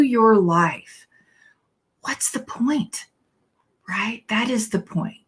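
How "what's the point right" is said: whispered aside. Real, not acted. Minister whispering a rhetorical question to her congregation. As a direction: whisper "what's the point" slowly.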